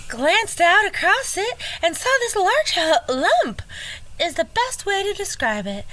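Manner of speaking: high, hammed-up Georgia belle; swooning Southern belle, pitched high. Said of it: "should I do it again? The Georgia?".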